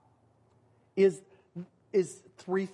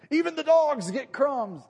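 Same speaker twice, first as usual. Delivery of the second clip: sudden, much higher and louder cry of protest; urgent objection; 9 semitones higher, 7 dB louder than usual. Retold or imitated. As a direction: cut in eagerly with a loud protest. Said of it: "responds to her going".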